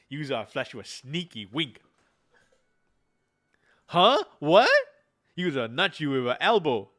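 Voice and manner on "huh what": high, loud surprised huh; startled huh. Acted. Daughter startled by the sneaky wink, high loud huh. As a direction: jump at the sudden question.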